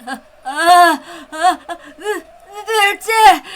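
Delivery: sobbing, slow, high panicked shout; choking, terrified wail over dead men; terrified cry. Acted. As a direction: stammer and wail in horror at the bodies.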